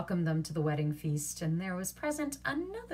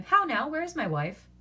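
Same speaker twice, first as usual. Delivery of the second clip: loud and raised; sharp impatience; impatient demand; 9 semitones higher, 6 dB louder than usual. A narrator's reading. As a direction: call out for her. Husband demanding.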